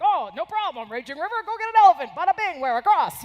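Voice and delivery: mocking the American can-do attitude, fast and loud; mock breezy confidence. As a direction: rattle it off like it's nothing, hammed-up.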